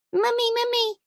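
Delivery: put-on high child calling Mummy; little twin crying out for mum; excited child. A narrator's reading. Child shouting to her mother, excited.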